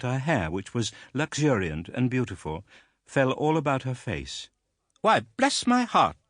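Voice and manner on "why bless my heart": put-on high, loud astonished old lady; exclaiming. A narrator's reading. Aunt astonished at the young widow, high exclamation. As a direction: burst out in surprise. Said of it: "exclaimed Miss Betsy".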